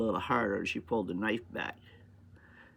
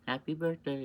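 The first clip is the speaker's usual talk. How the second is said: innocent greeting; 5 semitones higher than usual. Retold or imitated. Greeting daughter with straight face.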